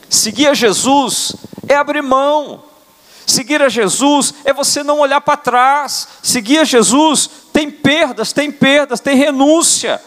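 high, ringing preacher's proclamation; impassioned sermon peak; preaching at full force. Acted. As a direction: lift it up and proclaim to the church. Preaching climax on following Jesus.

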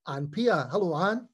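ordinary talk, nothing marked

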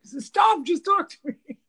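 loud, high-pitched begging, retold; acted-out fed-up protest; exasperated plea. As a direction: burst out at a relentless tease. Begging joking husband to stop.

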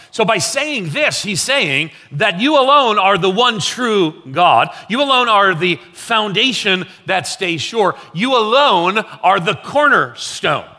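rising pulpit emphasis; preaching at full force. Acted. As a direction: drive it home from the pulpit. Pastor builds to a peak.

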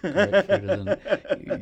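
mock-enthusiastic, high and loud with a laugh; sarcastic. Real, not acted. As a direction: oversell it sarcastically. Mocking hospital food sarcastically.

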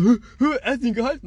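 high, mock-amazed gasping crowd; astonished reaction. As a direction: imitate teammates stunned by the save. Imitates everybody's astonished reaction.

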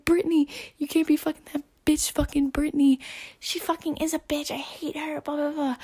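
very high, exaggerated jealous tirade; mock going off. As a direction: rant at him in a put-on high voice. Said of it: "you go off on this dude".

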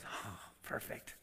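hushed, slow, whispered relish; scheming devil. Acted. Devil seeing their guard down, whispering slyly. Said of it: "the enemy, the devil, says".

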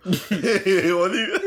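laughing tease, drawn out; amused disbelief through laughter. Real, not acted. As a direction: crack up while teasing a friend. Teases friend, laughing along.

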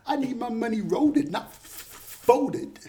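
high hammed-up greedy voice. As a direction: squeal it like a money-hungry boss. Jokey money-greed voice.

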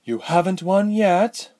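high, cross retort; smooth but cross. A narrator's reading. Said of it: "her smooth voice cross".

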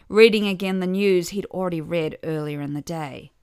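ordinary delivery

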